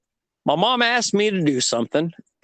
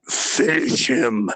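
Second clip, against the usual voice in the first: Mortal Kombat announcer; 6 semitones lower, slower than usual. Retold or imitated. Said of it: "As they say in Mortal Kombat".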